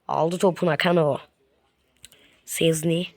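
normal talking voice

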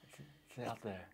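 soft, hushed and dreamy; hushed reverie about the pot of gold; mock dreamy hush. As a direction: tell it like a bedtime secret.

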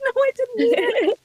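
very high, laughing protest; mock pleading. Niece begging auntie not to take her, mock pleading.

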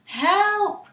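high loud cry of alarm; cry for help. Acted. Animals calling from the flood, high panicked cry. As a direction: call out in panic. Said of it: "cried Anansi".

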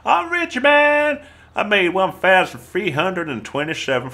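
very high, loud gloating cheer; over-the-top celebratory squeal; mock jubilation. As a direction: crow it high like a jackpot winner. Mock celebration at in-game payout.

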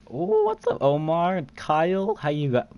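greeting that cracks up high. Real, not acted. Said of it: "Voice crack".

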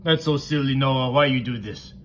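usual speaking voice

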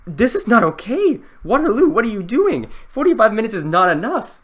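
high, loud indignant protest; exasperated outburst. Real, not acted. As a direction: protest loudly, pitch shooting up. Time running out in test.

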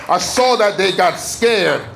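ordinary delivery

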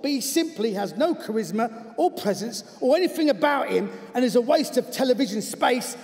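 slightly cockney letter reading, emphatic. Acted. Football coach denouncing a TV presenter by letter, in a cockney accent. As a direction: read it out as an indignant cockney. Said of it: "I'm gonna do it in his voice".

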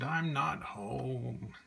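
slow, drawn-out moan in a changed voice; sulky moan from under the covers. A narrator's reading. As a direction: moan it out as if you can't be bothered. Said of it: "she moaned".

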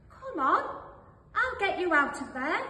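high, loud little-sheep voice; eager little sheep. Acted. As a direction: call out urgently to pull the wolf free. Sheep rescues the drowning wolf.

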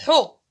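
حو said as "ḥu", high and loud; teacher sounding a letter; ha with damma. Shown as a demonstration. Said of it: "ماذا أقول لها؟".

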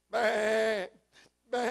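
a bleated baa from a lost sheep; sheep bleating. Retold or imitated. Preacher bleating like the sheep lost in a hole. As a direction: bleat it like a scared lamb.

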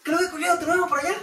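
very high-pitched mock Chilean; Chilean accent. A turn imitating Chileans, squeaky-high Chilean accent. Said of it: "eh, chileno".

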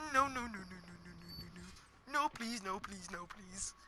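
fading pleading no's; anguished denial. Real, not acted. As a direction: beg quietly, growing fainter. Being caught by enemy.

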